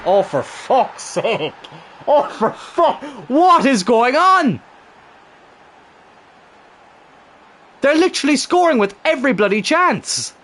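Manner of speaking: high, strained exasperated rant with bitter laughs. Real, not acted. Gamer watching his team get thrashed, exasperated high-pitched disbelief. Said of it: "I'm getting a bit annoyed at this".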